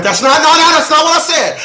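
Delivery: high, loud, cutting in with objections; mock indignant outburst. Man retelling a courtroom scene, hammed-up indignant protest. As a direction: act a flustered, high-pitched protest.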